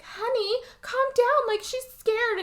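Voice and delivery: high, urgent soothing; calm him down in a high, worried voice; pleading stepmom. Retold or imitated.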